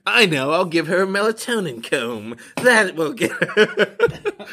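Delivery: high, slow scheming witch. Acted. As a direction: gloat over the evil plan. Witch plotting.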